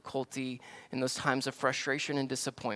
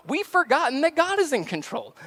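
normal talking voice

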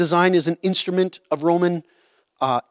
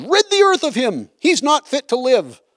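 raised, higher shout of the angry crowd; 6 semitones higher than usual. A narrator's reading. Preacher voicing the crowd against Paul, raised and shouting. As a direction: hurl it out like a mob demanding death. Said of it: "raised their voices and shouted".

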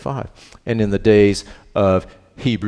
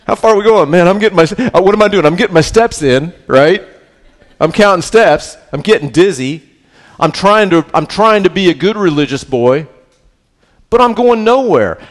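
high, loud, complaining voice of a believer going in circles; frustrated whiny believer; 8 semitones higher, 6 dB louder than usual. Retold or imitated. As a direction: whine it out like someone lost in the desert. Voicing a frustrated wandering believer.

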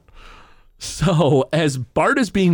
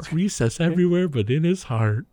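softer, rueful reread of the line; gently mournful, rising at the end; wistful repeat; 4 dB quieter than usual. Retold or imitated. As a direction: savour it with a touch of mock sorrow. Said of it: "repeats the line".

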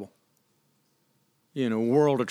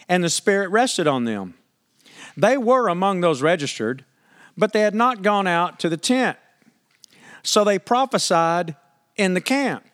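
scripture reading; 5 semitones higher than usual. Acted. Pastor reading Numbers to the congregation, measured. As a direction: read the verses with weight.

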